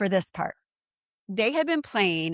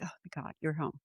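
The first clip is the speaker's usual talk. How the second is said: much softer, breathed out; hushed relief; 3 semitones lower, 11 dB quieter, faster than usual. Retold or imitated. Greeting her returning friend, hushed relief. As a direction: let it slip out under your breath.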